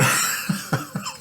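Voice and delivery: very high, breathy burst of laughter. Real, not acted. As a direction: let out a squeaky, airless laugh. Laughs at the standoff.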